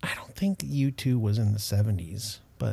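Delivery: whispered aside; whispering. Real, not acted. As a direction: whisper it under your breath. Quiet aside during game.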